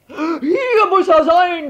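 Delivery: laut, hoch und gedehnt gekeucht; keuchend. A narrator's reading. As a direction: abgehetzt hervorstoßen. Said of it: "keuchte Herr Letscher". (keuchend vom Rennen)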